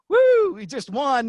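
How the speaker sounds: high, loud, slow whoop; mock celebration. Announcer fighting the urge to celebrate, high loud whoop. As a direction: let the woo burst out. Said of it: "where you have to say".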